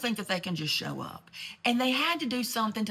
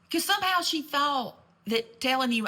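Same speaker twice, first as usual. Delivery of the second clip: indignant; 7 semitones higher, 5 dB louder than usual. Real, not acted. Indignant exclamation.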